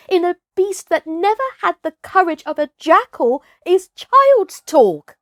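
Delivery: put-on a confident boy scoffing at the elders; young boy sneering at the tale; scornful jungle boy. Mowgli scorns Buldeo's tale.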